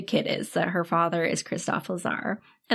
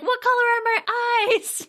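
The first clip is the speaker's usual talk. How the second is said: much higher, slow silly mock voice; mock kidnapper; slower than usual. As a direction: parody the goon's question. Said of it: "He's like".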